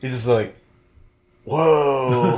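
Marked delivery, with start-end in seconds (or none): 1.5-2.4 lightly voiced surfer-dude whoa; a hint of a dazed movie-star whoa; Keanu Reeves impression. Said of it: "he just speaks in Keanu Reeves' voice".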